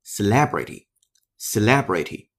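celebrity said slowly with stress on le, se-LE-bri-ty; slow model reading of celebrity, stress on the second syllable; correct pronunciation. Shown as a demonstration. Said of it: "正确发音只有一个". (慢读示范celebrity，重音在第二音节)

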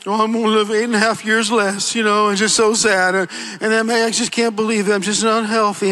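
a hint of a sorry-for-himself mumble; mock self-pity. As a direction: moan it like you've given up. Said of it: "start walking around saying".

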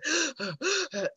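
demonstrated out-of-breath gasps; breathing hard. Shown as a demonstration. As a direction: heave for air, winded from the stairs. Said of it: "breathing hard like this".

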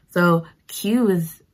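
normal talking voice

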